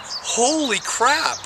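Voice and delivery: high, slow drawn-out amazement; astonished. Retold or imitated. Feeling the cord pull, he gasps in slow astonishment. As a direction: let it stretch in wonder. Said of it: "And I'm like".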